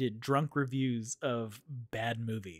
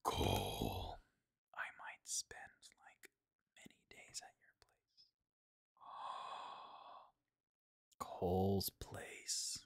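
whispering; 11 dB quieter, slower than usual. Real, not acted. Gasps at Cole's offer.

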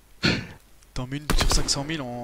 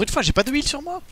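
high-pitched whine; 13 semitones higher, 6 dB louder than usual. Real, not acted. Frustrated gamer complaining mid-fight.

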